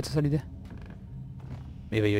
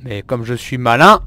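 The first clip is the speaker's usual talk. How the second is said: smug boast; 10 dB louder, slower than usual. Real, not acted.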